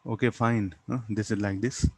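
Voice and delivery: ordinary delivery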